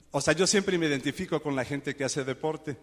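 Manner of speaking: usual speaking voice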